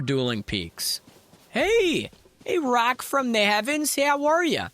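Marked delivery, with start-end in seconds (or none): 1.5-4.7 excited greeting. Real, not acted. Greets a rock landmark in game.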